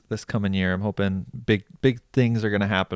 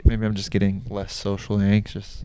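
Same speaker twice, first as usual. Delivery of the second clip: ordinary talk, nothing marked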